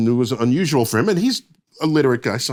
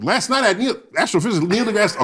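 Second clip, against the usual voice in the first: high-pitched imitation of a monologue delivery; Jay Leno; 6 semitones higher than usual. Guest retells the host's next-day monologue, high imitation. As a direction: mimic a talk-show host, pitched well up. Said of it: "okay, I won't imitate it".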